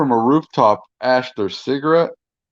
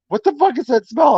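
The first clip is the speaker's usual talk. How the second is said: imitating wife; 11 semitones higher than usual. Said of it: "my wife is like".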